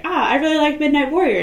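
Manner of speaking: a hint of a starstruck girl. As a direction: gush about the hero. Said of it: "she is like".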